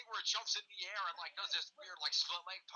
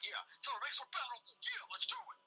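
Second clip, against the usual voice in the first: Jason impression; 9 semitones higher than usual. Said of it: "He's like".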